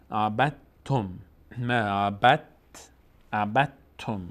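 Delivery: عبدتم said "abat-tum" with a firm double t; silent dal. Shown as a demonstration. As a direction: drop the d of عبدتم straight into t. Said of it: "اصلاً دال رو تلفظ نمی کنیم".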